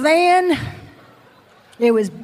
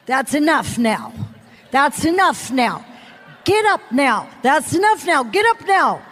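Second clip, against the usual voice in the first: stern command building; 2 semitones higher than usual. Retold or imitated. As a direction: push harder with each order until it cracks. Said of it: "He said".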